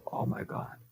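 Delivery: hushed, voiceless "oh my God"; whispered exclamation. Acted. Teacher acting out Pandora's box opening, whispered. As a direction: whisper it as a mock reaction.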